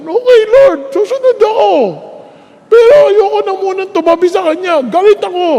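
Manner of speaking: high, loud petulant reply; a husband sulking at God; grudging sulk. Retold or imitated. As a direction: sulk like a child.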